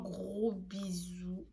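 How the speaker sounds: lent, soufflé, sans voix; whisper. Real, not acted. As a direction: envoie les bisous tout doucement. Bisous de fin de vidéo. (bisous chuchotés)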